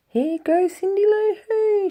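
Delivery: high, loud play-by-play call; sports commentator voice. Acted. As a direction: announce herself like a sportscaster. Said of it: "her best sports commentator voice".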